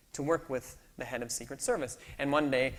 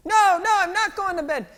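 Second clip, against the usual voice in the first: much higher, louder mock child wail; screaming toddler; 15 dB louder than usual. Sleepy daughter refusing dad, high mock child screaming. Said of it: "Ruthie starts screaming".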